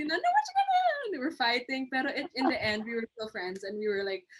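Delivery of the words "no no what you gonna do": frantic protest. Retold or imitated. Retold classmates fighting.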